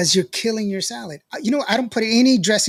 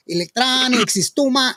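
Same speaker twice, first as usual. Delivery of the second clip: higher-pitched mock Spanish accent; 6 semitones higher, 3 dB louder than usual. Imitating Marco on why he avoids the show, mock Spanish accent. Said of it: "He doesn't speak that way".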